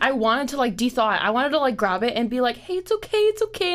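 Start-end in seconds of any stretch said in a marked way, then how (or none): 2.6-3.7 high, coaxing comfort; calm a tiny frightened animal; soothing a squirrel. Pretend. Imagined soothing of frozen squirrel.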